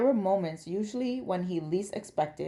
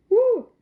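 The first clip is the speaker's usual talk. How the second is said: sudden high-pitched "woo"; high, loud whoop; 11 dB louder than usual. Acted. Ash illustrating her mam's eye roll, high whoop. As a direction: burst out with a loud, high hoot.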